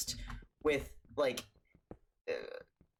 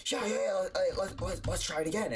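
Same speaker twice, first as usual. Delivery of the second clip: eager urging; 5 semitones higher than usual. Retold or imitated. Acting brother urging another try.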